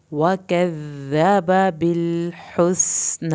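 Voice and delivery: slow, drawn-out tajweed chant; Quranic recitation. Shown as a demonstration. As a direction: recite each word slowly, melodically. Recites verse.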